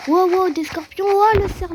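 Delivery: loud, high-pitched gamer yelp; alarmed surprise. Real, not acted. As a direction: cry out as threats suddenly appear. Scorpions and deer suddenly appear. (cri de surprise affolé, très aigu)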